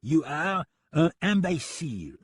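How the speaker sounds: put-on slow, high French-accented insult; taunting. French gunman calls the deputy a fool, taunting. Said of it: "He grinned tauntingly".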